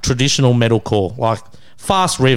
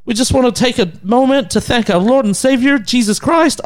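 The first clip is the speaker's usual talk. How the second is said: high-pitched earnest band thank-you speech; mock devout frontman; 10 semitones higher than usual. Band thanks the Lord between songs, mock devout. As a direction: imitate a pious singer addressing the crowd.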